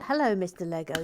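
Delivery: ordinary talk, nothing marked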